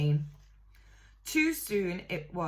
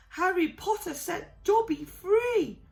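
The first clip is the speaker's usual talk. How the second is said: high, crying elf voice; overwhelmed with thanks; tearful elf; 12 semitones higher, 4 dB louder than usual. A narrator's reading. Elf overcome with gratitude.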